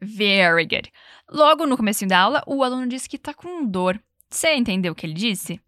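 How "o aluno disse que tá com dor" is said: usual speaking voice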